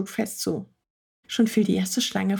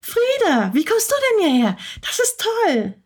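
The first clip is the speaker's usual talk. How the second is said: put-on much higher, louder, fast joyful girl's voice; overjoyed girl; 12 semitones higher, 4 dB louder than usual. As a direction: burst out in delight at seeing the cat. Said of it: "völlig außer sich vor Freude". (überglückliches Mädchen, viel höher und schnell)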